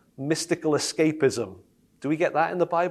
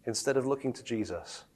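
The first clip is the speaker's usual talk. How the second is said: quiet realization; 7 dB quieter than usual. Retold or imitated. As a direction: let it dawn on you quietly. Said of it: "you go".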